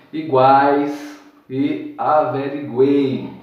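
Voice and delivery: iguais e averiguei drawn out slowly; slow syllables. Shown as a demonstration. Teacher slowly modelling iguais, averiguei. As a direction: stretch every vowel of iguais and averiguei. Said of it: "todas as vogais ou semivogais".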